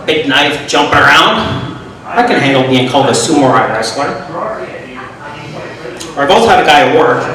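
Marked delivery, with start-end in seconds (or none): 3.1-4.1 "sumo" said as sumo-rye; mangled "sumo-rye wrestler"; botched word. Retold or imitated. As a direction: repeat the garbled form of "sumo", sumo-rye. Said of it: "he fucked it up a bit".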